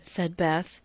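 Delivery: ordinary delivery, nothing marked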